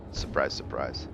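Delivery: ordinary delivery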